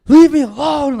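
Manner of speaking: loud, high-pitched mock yell; imitating furious shout. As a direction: yell it like an exasperated caller. Retold caller blowing up.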